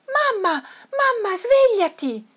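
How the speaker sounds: high little cub's squeal; excited squeal. A narrator's reading. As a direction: call Mum awake in a high, urgent child's voice. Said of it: "sguittì Toddy".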